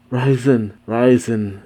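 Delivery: ordinary delivery, nothing marked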